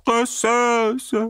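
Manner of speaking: very high, wailing whine; a pitiful squealing plea; the fat pig whimpering to his rider; whining pig-man. A narrator's reading. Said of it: "pleurnicha le porc gras".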